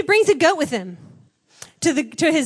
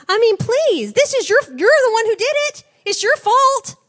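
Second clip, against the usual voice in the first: much higher and louder, scolding in disbelief; exasperated rebuke; 9 semitones higher, 6 dB louder than usual. Real, not acted. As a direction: call him out like a fed-up parent. Preacher rebukes Samson directly.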